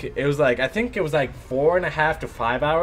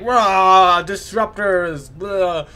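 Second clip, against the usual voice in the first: mock yell; 4 semitones higher, 6 dB louder, much slower than usual. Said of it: "I was, like, yelling".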